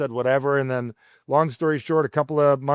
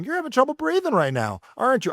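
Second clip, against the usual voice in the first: high, gentle questioning coach voice; calm coach; 11 semitones higher than usual. Retold or imitated. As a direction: ask kindly to name the feeling. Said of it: "someone's saying".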